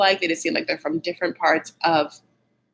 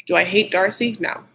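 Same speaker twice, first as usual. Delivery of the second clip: ordinary delivery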